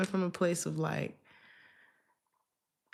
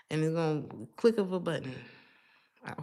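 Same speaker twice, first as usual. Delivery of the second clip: ordinary talk, nothing marked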